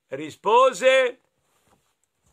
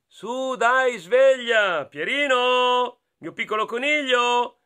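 a touch higher, slow coaxing mother; lightly voiced mother; 7 semitones higher, 3 dB louder, slower than usual. Mother waking her bunny, lightly higher and slow. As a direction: gently wake the little rabbit. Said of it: "la mamma gli diceva".

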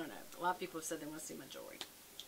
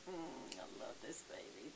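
tender whisper; 7 semitones lower, 8 dB quieter, slower than usual. Real, not acted. Whispering love to dog.

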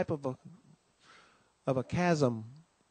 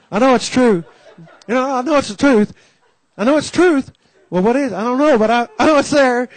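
high, loud insistent voice; fervent testimony; 7 semitones higher, 8 dB louder than usual. Retold or imitated. Church man insisting loudly he knows it's true. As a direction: insist with rising conviction.

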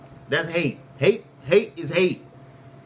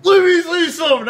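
high, loud imitation of someone butting in; mock outburst; 10 semitones higher, 6 dB louder than usual. Co-host re-enacts the other interrupting, mock yell. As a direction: mockingly yell for the floor. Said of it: "and he was like".

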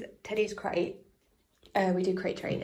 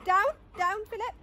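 excited; 8 semitones higher, 6 dB louder, much slower than usual. Real, not acted. Dogs jumping on her.

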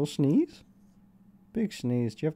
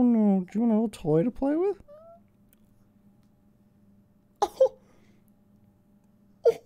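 put-on high, sing-song baby talk; baby talk to a puppy; 5 dB louder than usual. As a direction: coo at the little dog like a baby. Talking to the puppy.

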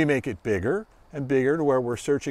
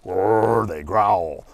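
growly dog; 8 semitones lower, much slower than usual. Retold or imitated. Imitating an unhappy dog.

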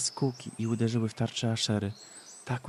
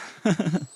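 mad cackle; 7 semitones higher, 4 dB louder than usual. Acted. Mad woman laughs.

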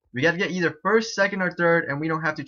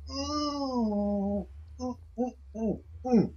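high-pitched drawn-out "ooh", then short ones; high little oohs while limbering up; stretching hoots; 10 semitones higher, 5 dB quieter, much slower than usual. Real, not acted. Streamer stretches before the game, high playful oohs.

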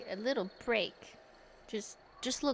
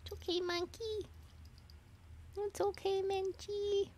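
higher, softer voice calming a scared cat; soothing pet talk; 10 semitones higher, 6 dB quieter than usual. Real, not acted. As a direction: reassure a frightened cat gently.

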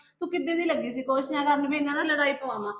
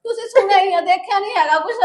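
loud pleading; 5 semitones higher, 9 dB louder than usual. Acted.

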